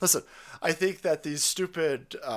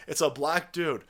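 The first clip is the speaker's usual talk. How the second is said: ordinary delivery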